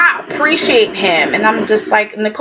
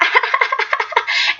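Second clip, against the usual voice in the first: high, breathy mock giggle; put-on gloating titter; imitated gleeful laugh; 10 semitones higher, 4 dB quieter than usual. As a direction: laugh like someone pleased with her luck.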